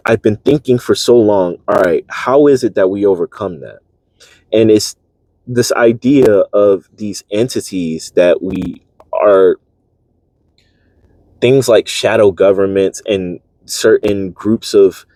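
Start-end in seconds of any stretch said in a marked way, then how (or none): none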